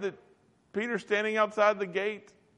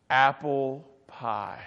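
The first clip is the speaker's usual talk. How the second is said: savoring; 4 semitones lower, much slower than usual. Retold or imitated. Smelling pie on coming home.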